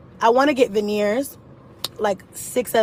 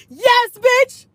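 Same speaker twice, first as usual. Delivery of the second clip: high, loud triumphant cheer; 11 semitones higher, 4 dB louder than usual. Real, not acted. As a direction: cheer for yourself. Celebrating her new tooth.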